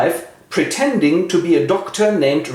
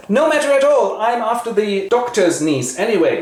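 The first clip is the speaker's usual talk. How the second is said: a pitched-up comic dunce; mock dunce; 8 semitones higher than usual. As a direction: brush it off up high. Dunce shrugs off rejection.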